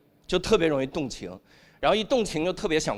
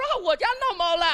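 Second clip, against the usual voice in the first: high, fast mock-excited cry; excited outburst; 14 semitones higher, faster than usual. New father answering the nurse, high excited outburst.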